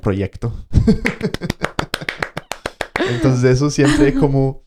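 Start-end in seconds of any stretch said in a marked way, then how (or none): 0.6-3.0 an unvoiced laugh; breathy laugh. Real, not acted. Laugh after the prophecy.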